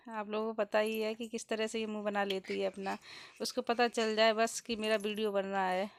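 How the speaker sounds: ordinary talk, nothing marked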